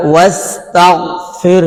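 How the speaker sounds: slow recited ওয়াস্তাগফিরহা; Quran recitation. Shown as a demonstration. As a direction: chant it slowly with a held vowel. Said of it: "একক করে ছাড়বেন".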